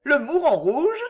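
high, rising curiosity; lightly acted, intrigued question; amused question. A narrator's reading. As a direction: echo the name in bright puzzlement. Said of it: "questionna Suzanne, riant gaiement".

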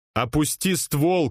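slow, high yelled command; shouted order. Acted. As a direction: bark it at the gunman, slow and hard. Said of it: "крикнул я".

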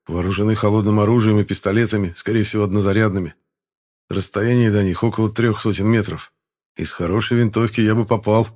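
ordinary delivery, nothing marked

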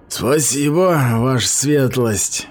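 slow, sneering mock thanks; sneering through teeth. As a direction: drawl it out with contempt. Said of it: "процедил Грёта".

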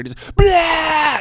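loud, high startling shout; scare shout. Retold or imitated. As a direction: yell to make them jump. Dad jumping out to scare.

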